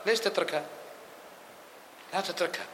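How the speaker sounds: ordinary delivery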